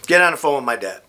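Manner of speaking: normal talking voice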